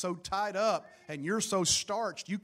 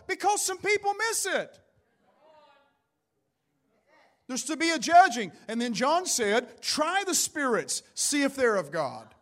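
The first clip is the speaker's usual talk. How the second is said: high, forceful pulpit delivery; raised preacher's voice, punching the key words; fervent preaching; 7 semitones higher, 6 dB louder than usual. Real, not acted. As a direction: press the warning on the congregation.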